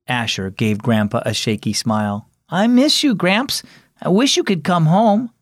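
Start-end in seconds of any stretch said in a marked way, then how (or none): 2.5-5.3 put-on higher-pitched young grandson; grandson's plea. A narrator's reading. Grandson to his grandpa in hospital, mildly wistful. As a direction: wistfully tell grandpa you miss him.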